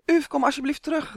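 high, loud pleading call. A narrator's reading. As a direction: call after the dragonfly, begging. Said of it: "riep hij". (hoog en luid smekend roepend)

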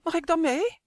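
a small chick asking eagerly to come along; little chick asking. A narrator's reading. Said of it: "Kuiken vraagt".

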